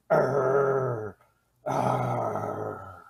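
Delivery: long, drawn-out animal-like growls; imitated growl. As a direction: imitate a snarling star like a wild animal. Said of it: "growling, snarling".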